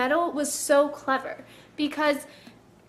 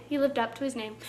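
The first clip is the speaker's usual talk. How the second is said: softer, lower muttered echo; quiet repeat; 4 semitones lower, 8 dB quieter, faster than usual. Shown as a demonstration. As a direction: say it again under your breath.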